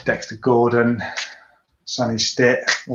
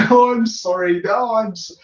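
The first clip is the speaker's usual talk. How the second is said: much higher, louder overlapping sorries; mock flustered apology, much higher; 11 semitones higher, 4 dB louder than usual. Cyclist apologising back to Marjorie, much higher and louder. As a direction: trip over your own apology.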